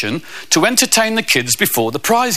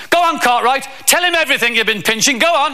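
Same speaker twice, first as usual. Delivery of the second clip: higher-pitched, brisk teacher's order; imitated bossy teacher; 8 semitones higher than usual. Passing teacher orders a pupil to confess, mock bossy.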